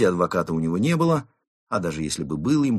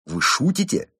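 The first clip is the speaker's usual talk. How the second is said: high, louder, incredulous question; indignant; 12 semitones higher, 5 dB louder than usual. A narrator's reading. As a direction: protest in outraged disbelief. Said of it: "возмутился он". (возмущённое недоумение)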